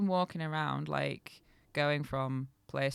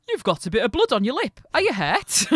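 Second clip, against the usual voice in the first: loud, quick, higher Yorkshire voice; Yorkshire traveller; 7 semitones higher, 11 dB louder than usual. A narrator's reading. Young addressing bloodied old woman, Yorkshire traveller. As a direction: blurt it in broad Yorkshire. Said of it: "go full Yorkshire for him".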